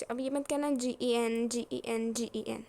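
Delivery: loud, repeated spelled-out letters; head off the silly answer at full voice; emphatic warning. Real, not acted. Teacher warning students off the obvious answer, loud and emphatic.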